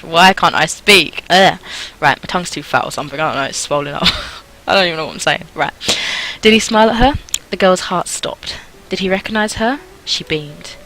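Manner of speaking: normal talking voice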